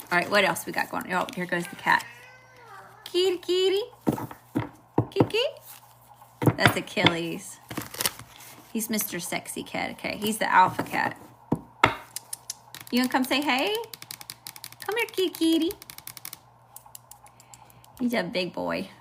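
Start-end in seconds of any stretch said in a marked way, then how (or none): 3.0-6.6 high-pitched, coaxing kitty call; calling a cat. Real, not acted. Reader calls her cat over mid-reading, high cooing call. 12.9-15.7 high, cooing pet talk; coaxing a pet. Real, not acted. Reader invites her cat to greet viewers, high coaxing voice.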